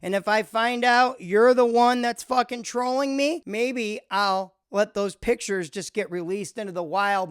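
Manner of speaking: ordinary delivery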